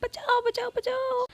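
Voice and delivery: high, frightened shrieks; scream for help. Acted. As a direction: scream for help as the goons close in. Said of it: "Sarika चिल्लाने लगती है". (डरी हुई ऊँची चीख़)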